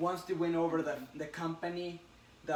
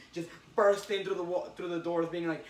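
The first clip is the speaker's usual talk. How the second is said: ordinary delivery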